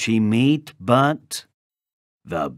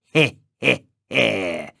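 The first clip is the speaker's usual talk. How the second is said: sly, snarling "he he he" chuckle; wicked wolf's short spaced snigger; snarled wolf snicker. A narrator's reading. Wolf pleased with his disguise, snarly snicker. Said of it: "he snarled".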